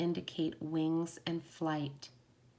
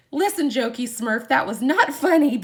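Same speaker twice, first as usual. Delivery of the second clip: loud, higher, playful telling-off; hammed-up indignation, loud and pitched up; mock scolding; 6 semitones higher, 10 dB louder than usual. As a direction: scold a friend in pretend outrage. Retold mock scolding of Matt.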